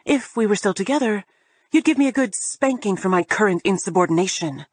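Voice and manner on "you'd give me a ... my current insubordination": plain reading voice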